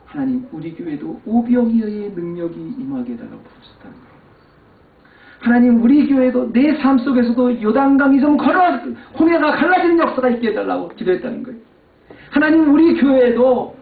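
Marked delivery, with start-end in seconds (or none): 5.4-13.8 high, loud preaching cry of a prayer; fervent preaching. Acted. Pastor mimicking other preachers' prayers, fervent loud preaching. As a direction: soar up and plead like a revival preacher.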